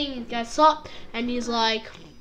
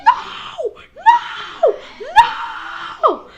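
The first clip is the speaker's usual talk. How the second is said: high, drawn-out hammed-up cries; despairing mock wail; 14 semitones higher, 5 dB louder, much slower than usual. Goku watches Roshi being beaten, mock despairing wail. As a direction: wail each one in mock horror.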